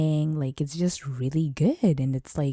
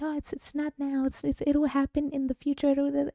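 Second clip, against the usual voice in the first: mock self-reassurance; 11 semitones higher than usual. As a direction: mimic someone kidding themselves in a thin high voice. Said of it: "when you're just like".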